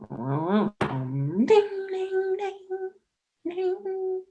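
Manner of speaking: high chiming dings rising up; imitating the bell. Showing how the dog rings the bell, high chiming dings. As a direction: ring it out like a bell. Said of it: "So he's".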